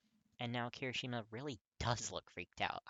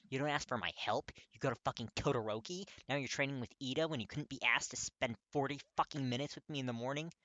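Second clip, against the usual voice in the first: furious; 4 semitones higher, 6 dB louder than usual. Acted. Katsuki yelling at Kirishima.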